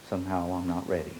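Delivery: ordinary talk, nothing marked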